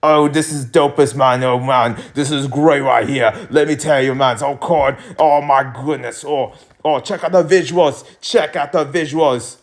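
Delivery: put-on British accent impression. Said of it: "let me do my K S I accent".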